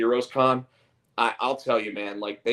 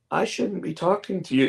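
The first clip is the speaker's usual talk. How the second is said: put-on kid's raised, wary little voice; little kid voice, higher; 8 semitones higher than usual. Kid to stranger with balloons, a higher little voice. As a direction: play the small boy, pitched up. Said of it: "And the kid's like".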